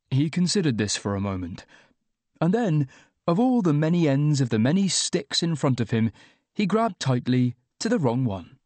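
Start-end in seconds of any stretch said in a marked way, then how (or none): none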